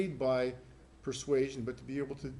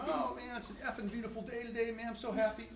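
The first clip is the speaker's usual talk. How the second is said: pitched up cheerful gush about a lovely day; mock happy exclamation; 8 semitones higher than usual. Shown as a demonstration. Teacher demoing harmless swearing, cheery and high. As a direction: gush happily, higher than usual. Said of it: "I'm just going".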